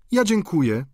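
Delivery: put-on high, polite old lady; polite refusal. A narrator's reading. Madame Couture politely refusing the outing. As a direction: decline the invitation politely.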